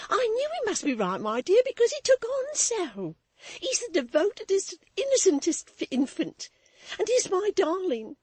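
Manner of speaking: high tearful gushing; crying while she praises him; burst of tears. A narrator's reading. Maid hugging Florence, high and tearful. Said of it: "burst of tears".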